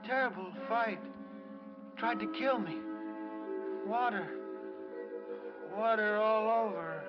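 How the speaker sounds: high, halting fragments with long gaps; delirious. Acted. Shocked patient reliving a murder and flood, delirious. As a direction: rave as if trapped in a nightmare.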